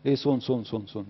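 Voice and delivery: صون repeated as a swallowed fragment of ليسون; mock the slurred ending صون; clipped mock syllable. Shown as a demonstration. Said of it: "مين صون ده؟". (تقليد ساخر لنطق صون)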